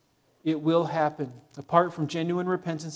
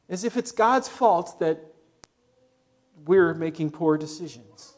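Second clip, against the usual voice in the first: usual speaking voice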